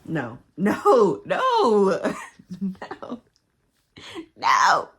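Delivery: loud, high shouted no's; loud denial. Real, not acted. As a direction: shout no in disbelief. Reacting in disbelief.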